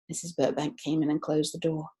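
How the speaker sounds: plain reading voice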